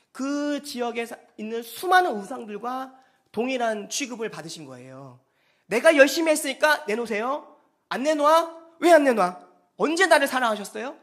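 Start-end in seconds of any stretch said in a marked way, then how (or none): 5.7-11.0 loud, indignant challenge to God; mock an entitled worshipper complaining; demanding complaint. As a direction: press your grievance loudly. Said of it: "이렇게 하나님께 이야기하는 거죠".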